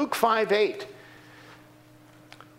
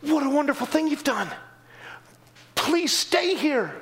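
imagined townspeople's plea; 7 semitones higher than usual. Pretend. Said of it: "I would expect they'd be saying".

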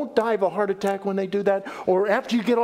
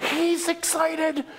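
excited; 6 semitones higher, slower than usual. Shown as a demonstration. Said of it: "he is fervent with his blessing".

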